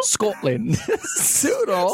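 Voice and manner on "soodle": high, laughing Soodle for Seattle; mock local accent. Laughing with the cohost over the city, mock-local Soodle. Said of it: "pronouncing these cities as they're pronounced".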